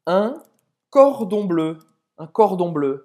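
announcing phrase. Shown as a demonstration. Presenting a new expression.

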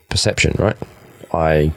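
usual speaking voice

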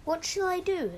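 high-pitched storybook fretting; mock dismay in a high voice; mild worried cry. A narrator's reading. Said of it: "cried Lou".

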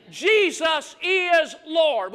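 slow, loud proclaiming; slow shouted creed; preached declaration. Shown as a demonstration. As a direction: stretch it out as a call for the church. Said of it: "Would you say that with me?".

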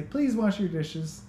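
"Please" said high and slow, sweetened; polite please, pitch up. Shown as a demonstration. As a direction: lift the voice high on "Please wash your dishes". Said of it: "even the way I say that".

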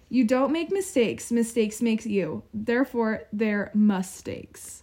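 reading the motto slowly and deliberately; slow quoted motto. Retold or imitated. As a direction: savor each word like a proverb. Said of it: "[name]'s motivation Monday for today is".

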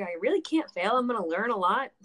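usual speaking voice

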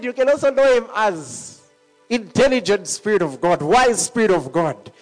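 usual speaking voice

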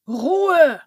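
a sudden loud call for silence; shouting for quiet. A narrator's reading. Santa silencing the arguing fairies, loud shout. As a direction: cut through the noisy room with one loud word. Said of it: "rief der Weihnachtsmann".

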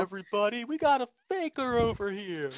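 high, drawn-out cartoonish cop call; mock Disney cop. Co-host playing a Disney cop who caught a faker, mock cop voice. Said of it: "is that what the cops sound like".

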